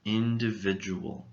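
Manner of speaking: "Individual" said slowly and clearly, all five syllables; careful model word. Shown as a demonstration. Teacher modeling "Individual" clearly for students. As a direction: model "Individual" cleanly for learners. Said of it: "we'll be looking at the word individual".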